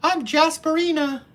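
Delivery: hammed-up falsetto introduction; mock feminine persona. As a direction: pretend to be a woman named Jasperina. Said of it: "slap a wig on in the morning and be like".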